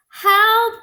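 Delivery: high, loud panicked cry; cry for help. Acted. Toad calling as his list blows away, panicked cry. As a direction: yell it as the list flies off. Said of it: "cried Toad".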